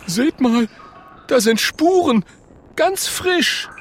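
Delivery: high-pitched little character voice; urgent high-voiced discovery; alarmed Oskar. Oskar shows his friends fresh tracks, alarmed.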